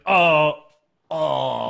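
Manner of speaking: loud hammed-up pained groans; mock pained groans. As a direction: groan loudly like a hurt wrestler. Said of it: "he's like".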